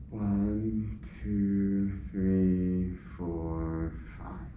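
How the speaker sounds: slow, soft, low count; calming breath count. Shown as a demonstration. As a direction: count each deep breath out quietly, drawing it out. Demonstrates counting through deep breaths.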